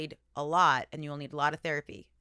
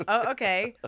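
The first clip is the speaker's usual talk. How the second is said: ordinary delivery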